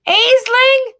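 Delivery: high, loud call across the chase; shout. A narrator's reading. Boy shouts to the girl to get the horses. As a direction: yell her name over the hooves. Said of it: "the boy shouts".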